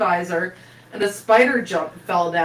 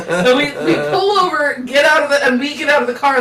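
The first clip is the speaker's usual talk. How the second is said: usual speaking voice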